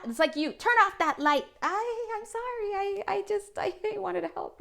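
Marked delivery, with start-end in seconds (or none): 0.5-1.5 high, loud mock order; mock shout. Voicing someone who refuses the light, mock shouting. 1.6-4.6 high, faltering little apologetic voice; meek apology voice. Acted. Voicing the scolded helper who meant well, meek and high.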